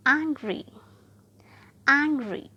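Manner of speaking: Angry with AN loud and falling; stress on first syllable. Shown as a demonstration. Teacher demonstrating first-syllable stress on angry. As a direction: hit AN in angry. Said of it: "first syllable पर trace किया".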